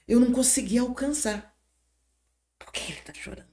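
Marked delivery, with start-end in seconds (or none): 2.6-3.5 whisper. Retold or imitated. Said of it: "whispered aside to herself".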